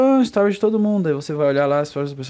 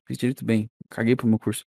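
muttered; 5 semitones lower, 5 dB quieter than usual. Real, not acted.

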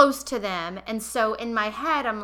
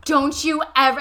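louder, higher mock yell; retold yell, loud and higher; 5 semitones higher, 8 dB louder than usual. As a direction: shout it at him again. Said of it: "And I yell".

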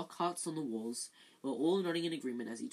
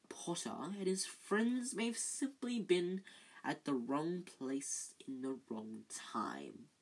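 slow, softer sneer; sneering Snape; 4 dB quieter, slower than usual. Acted. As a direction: let contempt curl every word. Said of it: "a slight sneer curling".

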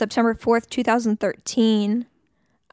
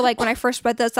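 ordinary delivery

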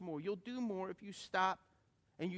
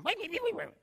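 higher, squeaky babbling imitation of the old wife; high mock Cajun wife; 13 semitones higher, 5 dB louder, faster than usual. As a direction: chatter like a fast-talking old Cajun lady. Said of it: "she's going".

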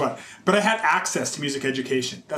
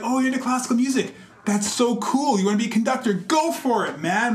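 higher, quick mock of the cousin's friends; Brooklyn friends; 6 semitones higher than usual. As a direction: cheer him on casually, pitched up.

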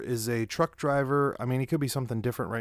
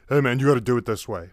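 loud, quick know-it-all coworker voice; bossy coworker; 6 dB louder, faster than usual. Retold or imitated. Coworker ordering him around at the kitchen. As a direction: bark the order like a pushy coworker.

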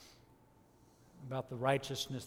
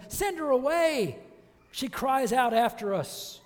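high, loud, slow demand; urging loudly; 10 semitones higher, 8 dB louder than usual. Acted. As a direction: call out an exasperated appeal, drawing it out. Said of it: "his disciples came and urged him".

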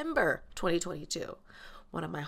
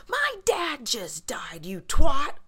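slow, loud, higher hammed-up Lala snap; imitating Lala; 7 semitones higher, 10 dB louder, much slower than usual. Quoting Lala's famous line to Megan, hammed-up and loud. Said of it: "Lala's infamous quote where she's like".

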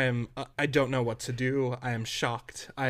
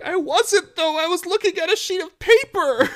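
high, loud defensive protest; 8 dB louder than usual. Retold or imitated. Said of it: "I'm like".

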